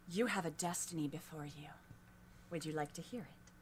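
hushed prophetic whisper; softly whispered offer of a destiny; mysterious whisper. Acted. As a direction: lean in and whisper it.

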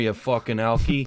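put-on Canadian accent. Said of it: "very Canadian accent?".

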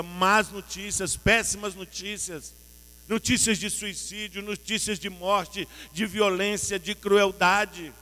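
loud, rising pulpit preaching, each phrase hammered; preaching at full force. Acted. Pastor to congregation about bad news, full-force preaching.

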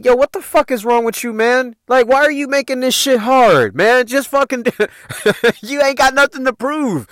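high-pitched, exasperated pleading; exasperated brother. Retold or imitated. Brothers arguing with their sister.

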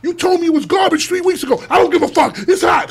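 high, insistent imitated phone hollering; retold heated exchange. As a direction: argue excitedly over club noise. Retold excited phone exchange from club.

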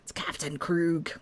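lightly voiced whispered pirate. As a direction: growl it like a hammy pirate. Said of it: "sounds like a pirate name".

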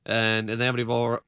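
normal talking voice

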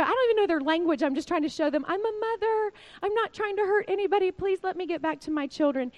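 retold desperate plea, high and rushed; pleading mother. Mother begging captors to let her go, pleading. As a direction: plead high and fast, imitating a frightened mother.

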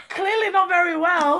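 high, loud mock wail; hammed-up tearful outburst; crying. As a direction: wail it slowly like you're falling apart. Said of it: "And I was like this".

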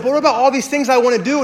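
high, loud, rushed objection; pleading protest. Retold or imitated. Groom protesting to his mentor about losing his things, pleading. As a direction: plead it in a hurried mock whine.

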